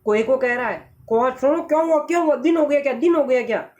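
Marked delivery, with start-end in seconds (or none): 1.3-3.7 high, excited repeated questions; a flustered crow pitched high; startled asking. Acted. (हड़बड़ाए कौवे की तरह पूछते हुए)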